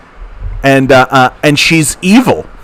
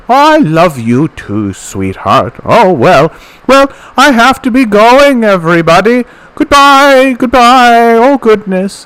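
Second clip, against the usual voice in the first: put-on sweet old lady voice; 10 semitones higher than usual. Streamer imitating Mom's public persona, high sweet old lady. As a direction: coo it like a doting grandma. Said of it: "Then she talks like this".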